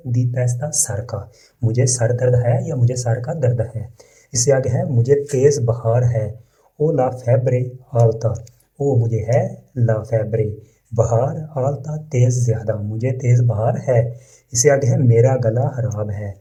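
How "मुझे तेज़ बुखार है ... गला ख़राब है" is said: normal talking voice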